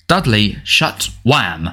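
ordinary delivery, nothing marked

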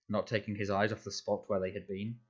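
ordinary delivery

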